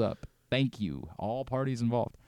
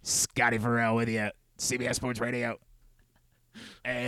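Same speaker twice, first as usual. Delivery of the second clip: lightly done veteran radio host voice; a hint of an old sports-radio intro; Scotty Farrell; 5 dB quieter than usual. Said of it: "I sound like, uh, Scotty Farrell".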